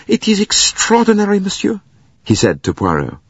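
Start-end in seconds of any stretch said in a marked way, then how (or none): 0.0-1.8 high-pitched flustered concierge; concerned, apologetic. A narrator's reading. Concierge breaks bad news to Poirot, high and apologetic. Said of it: "He looked concerned and apologetic".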